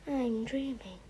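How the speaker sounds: faint weak murmur. Acted. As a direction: murmur it as if half asleep. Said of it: "she murmured".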